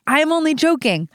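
ONLY JOKING exclaimed high on a swooping pitch; emphatic exclamation. Acted. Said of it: "that emphasis".

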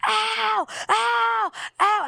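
high, loud wails of "ow"; imitated pain cries. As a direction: cry out repeatedly, imitating pain. Retold cries while being hit.

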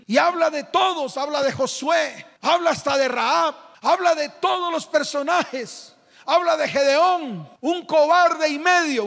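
high, ringing sermon climax; preaching at full force. Acted. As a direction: preach it out with rising fervor. (predicando con fuerza)

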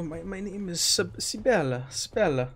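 soft, dazed, quiet Italian voice; dazed Italian woman. Acted. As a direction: sound disoriented and half there. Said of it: "disoriented and out of it".

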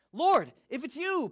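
high, loud, drawn-out call; acted calling out. A narrator's reading. Peter calling to Jesus from the boat, loud acted call. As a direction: call across the water as Peter. Peter calls across the water.

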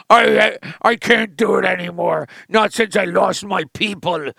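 put-on drunk washed-up general. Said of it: "he's a drunk and he's a piece of shit".

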